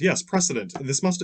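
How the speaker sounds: usual speaking voice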